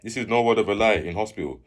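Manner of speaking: plain reading voice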